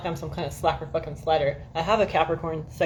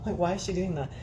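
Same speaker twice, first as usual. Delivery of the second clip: inner thought; 7 dB quieter than usual. Retold or imitated. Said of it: "I'm like".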